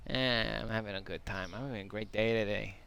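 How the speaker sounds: normal talking voice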